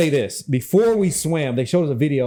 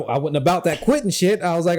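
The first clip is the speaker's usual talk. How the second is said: normal talking voice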